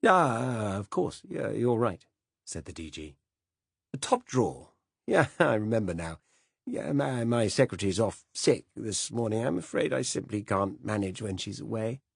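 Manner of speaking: a fussy, absent-minded British gent apologizing; vague old Director General. Acted.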